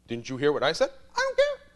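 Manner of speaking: loud, rising to a high cheeky "care"; teasing echo. Retold or imitated. Priest mirroring the student's words back, cheeky. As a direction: toss it back with a grin.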